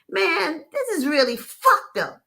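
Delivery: high, disbelieving aside to a friend; Jody to the narrator, high disbelief; incredulous. Retold or imitated. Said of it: "Jody looked at me and said".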